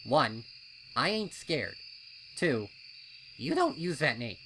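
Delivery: loud, indignant colt voice; a colt snapping back at a nickname; annoyed colt. A narrator's reading. Snips glaring at Snails, loud and indignant. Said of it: "Snips turned and glared at his friend".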